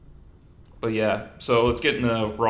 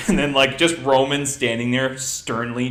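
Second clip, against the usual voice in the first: ordinary talk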